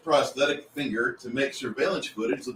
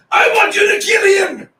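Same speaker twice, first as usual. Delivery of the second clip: high, loud shouted demand; comic over-the-top scream of an order; mock murderous shout; 10 dB louder than usual. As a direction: bellow it like a hammy hitman order. Acted blunt request in meme.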